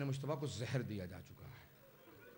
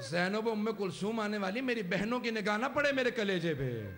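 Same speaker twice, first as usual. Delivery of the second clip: mourning cry building higher and louder; impassioned lament; 4 semitones higher, 4 dB louder than usual. Acted. Dying man hiding his suffering from sisters, impassioned lament. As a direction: let the plea swell into a wail. Lament voicing the poisoned Imam.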